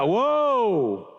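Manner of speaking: long WHOA, pitch swelling up and falling; drawn-out whoa. Shown as a demonstration. Preacher coaching the congregation on the first word, drawn-out whoa. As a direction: stretch WHOA right out, big and sweeping. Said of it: "I wanna start talking like that".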